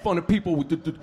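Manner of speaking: imitated scolding with a stammer; lecture, tripping on first consonants; stuttering lecture. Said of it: "he's lecturing me".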